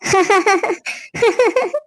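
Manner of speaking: a long, playful giggle at her own joke; teasing laugh. Acted. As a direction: laugh like you fooled him back. Wife reveals she was teasing. (கிண்டலான சிரிப்பு)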